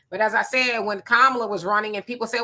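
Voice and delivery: ordinary delivery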